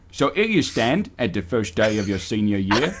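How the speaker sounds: a hint of Michael Caine reading the narration; half-held Michael Caine impression that slips. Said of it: "Read it in Michael Caine".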